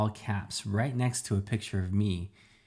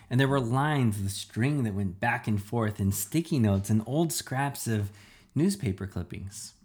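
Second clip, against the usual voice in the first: usual speaking voice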